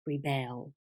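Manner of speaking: rebel as a verb, ri-BEL; low, softly said rebel with a stressed BEL; stress on second syllable. Shown as a demonstration. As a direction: land on the end of rebel. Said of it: "when it's a verb".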